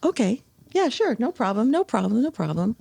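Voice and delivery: high, breezy mock reassurance; imitating Oscar. Oscar brushing off Ella's worry, high and breezy. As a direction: toss off the promises like a sly prankster. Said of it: "And he said".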